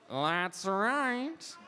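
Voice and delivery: drawn-out cartoon skeleton villain voice; Death. Shown as a demonstration. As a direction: stretch it out with a Skeletor-like swoop. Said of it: "go-to line to get Death is".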